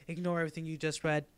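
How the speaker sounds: ordinary talk, nothing marked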